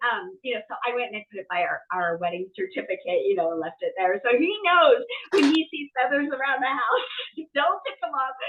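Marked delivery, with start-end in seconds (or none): none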